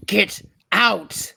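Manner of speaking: growled monster threat; growling bugbear. Acted. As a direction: growl it at them like a beast.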